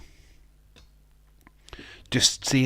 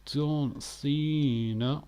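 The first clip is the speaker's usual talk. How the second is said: very slow, higher, drawn-out name call; mock ring announcer; 5 semitones higher, 3 dB quieter, much slower than usual. Host introducing John Cena like a ring announcer, drawn out. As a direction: stretch the name like an arena announcer.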